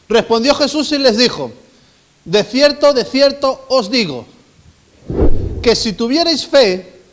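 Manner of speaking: loud, measured Bible verse reading; solemn scripture reading. Acted. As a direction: read the verse out with weight.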